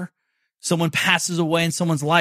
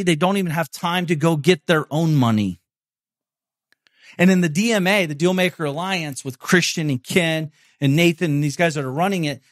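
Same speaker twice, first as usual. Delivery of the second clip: ordinary talk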